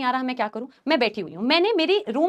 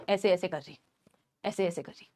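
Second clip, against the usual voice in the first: imitated possession; 8 semitones lower, 10 dB quieter than usual.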